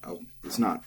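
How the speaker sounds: normal talking voice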